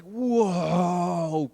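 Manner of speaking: long drawn-out whoa; awestruck fear. Acted. Disciples after the storm calms, awestruck whoa. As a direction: stretch it out in fearful awe. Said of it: "there was fear that was just like".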